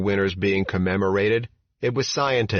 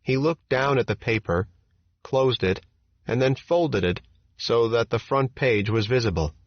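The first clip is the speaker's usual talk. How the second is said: ordinary delivery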